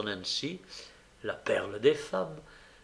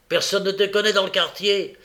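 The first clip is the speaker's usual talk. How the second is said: raised, loud argument; forceful insistence; 6 semitones higher, 7 dB louder than usual. A narrator's reading. Sikes argues Nancy into going, loud and insistent. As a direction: push her hard to agree.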